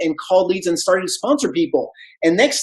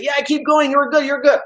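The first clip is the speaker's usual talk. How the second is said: lightly voiced, higher-pitched encouragement; retold upbeat reassurance, pitched up; encouraging mentor; 7 semitones higher than usual. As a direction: imitate a mentor cheering someone on.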